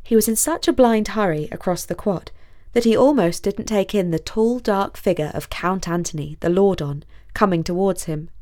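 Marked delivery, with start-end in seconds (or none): none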